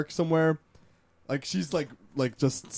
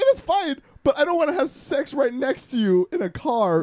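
incredulous protest; 11 semitones higher, 3 dB louder than usual. Real, not acted. Laughing protest at the idea.

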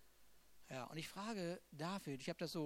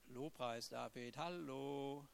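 ordinary talk, nothing marked

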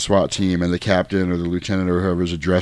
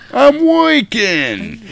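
high, very drawn-out New York holler; cartoon New Yorker, stretched right out; New York accent; much slower than usual. Host mocking a New York jerk, stretched New York accent. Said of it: "New York accent".

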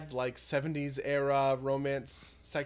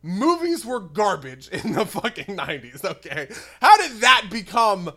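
loud exasperated rant; 4 semitones higher, 9 dB louder than usual. Real, not acted. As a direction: vent it, laughing in disbelief. Ranting about nineties movies.

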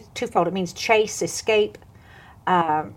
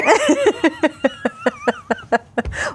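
long high real laugh; burst of laughter. Real, not acted. The speaker laughs retelling the boy's hair question, real high laughter. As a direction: laugh it off fully.

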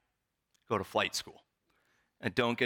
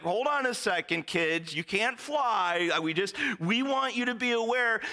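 flustered interviewer; 12 semitones higher, 3 dB louder than usual. Retold or imitated. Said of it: "The interviewer is like".

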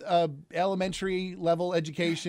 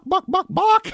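high, laughing chicken cluck; silly clucking, breaking into a laugh; 9 dB louder, slower than usual. Pretend. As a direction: cluck the punchline like a hen.

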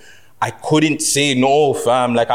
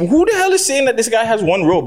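exasperated; 10 semitones higher than usual. Real, not acted. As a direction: call out the dumb critics. Mocking commenters' robe claims.

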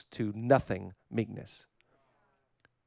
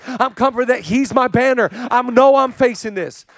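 loud, high, driving sermon delivery; full-force preaching; 10 semitones higher, 11 dB louder than usual. Real, not acted. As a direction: pile up declarations with full conviction.

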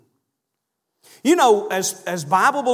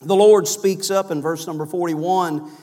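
ordinary talk, nothing marked

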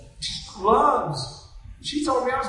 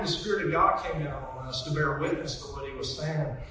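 ordinary talk, nothing marked

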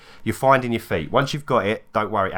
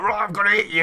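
mock battle roar; 10 semitones higher than usual. Imitates player angrily attacking in fight.

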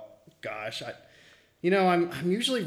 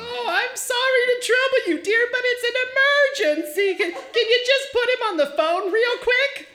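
much higher falsetto fake mother; fake mom voice; 3 dB louder than usual. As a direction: put on a high sweet old lady. Caller faking Sam's mother.

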